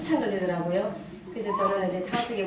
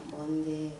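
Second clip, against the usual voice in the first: low, soft, feeble voice; husband barely recovered, mumbling softly; weak reply; 7 semitones lower, 8 dB quieter, much slower than usual. Retold or imitated. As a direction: answer with no strength left. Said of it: "그냥 기운 없는 말로".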